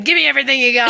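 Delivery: imitated robber. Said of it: "He was like".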